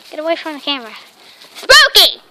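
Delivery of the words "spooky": high, loud call; calling the dog. Real, not acted. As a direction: yell the name.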